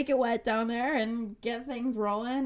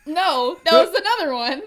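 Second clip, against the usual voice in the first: loud; 9 semitones higher, 14 dB louder than usual. Real, not acted. Teasing friend, loud outburst.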